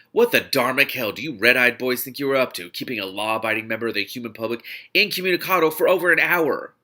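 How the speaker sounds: furious outburst. Acted. Mel storming in, furious at the red-eyed boys, loud indignant outburst. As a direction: demand answers, loud and outraged. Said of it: "still quivering with fury".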